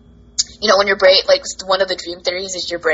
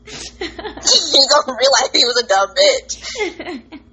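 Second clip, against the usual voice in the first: laughing; 6 semitones higher, much slower than usual. Real, not acted. Laughing taunt about ex.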